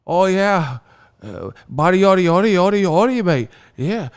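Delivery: put-on high British-accented gibberish; mock British accent. Mocking UK fighters, high British-accented babble. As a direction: babble like a hammed-up British fan. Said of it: "The U K fighters".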